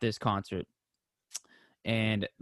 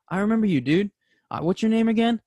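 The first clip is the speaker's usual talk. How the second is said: high, loud, excited voicing of the singer; 9 semitones higher, 6 dB louder than usual. Singer greeting a fan after the concert, high excited voice. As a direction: play him thrilled to see a fan.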